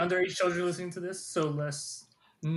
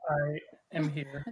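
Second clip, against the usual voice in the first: drawn-out, low and soft; slow and deep; 3 semitones lower, 8 dB quieter, much slower than usual. Real, not acted. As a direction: stretch every word slowly. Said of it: "Why did he say it like that?".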